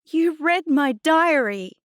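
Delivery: high, loud, slow accusation; outraged accusation. A narrator's reading. Girl confronting Adnan over her diary, outraged. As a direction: fling it at the culprit in fury.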